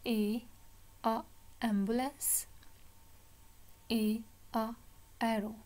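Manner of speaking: "A", short "a", then "ambulance", each set apart; letter, sound, word. Shown as a demonstration. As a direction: sound out "A, a, arrow" with gaps. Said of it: "So अब हम पढ़ेंगे". ("A, a, arrow" अक्षर, ध्वनि, शब्द अलग-अलग)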